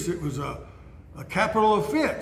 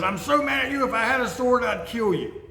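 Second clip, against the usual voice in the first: higher, mock furious outburst; enraged Balaam; 7 semitones higher than usual. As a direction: play the raging rider, pitch thrown up. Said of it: "he gets in a rage and he says".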